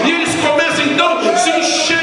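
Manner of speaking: high-pitched, insistent revival preaching; fervent pulpit cry; full-force preaching. Real, not acted. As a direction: build relentlessly in rising, chanted waves.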